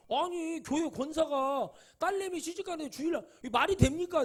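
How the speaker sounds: indignant protest. Retold or imitated. Young preacher protesting to his pastor about a Sunday wedding, indignant. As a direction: protest like you're confronting your pastor again. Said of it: "내가 막 그때 그랬거든요".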